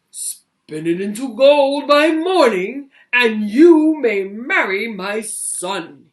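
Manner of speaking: loud, slow, airy royal command; king demanding gold yet again; greedy king. Acted. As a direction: press her harder like a greedy king. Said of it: "he said".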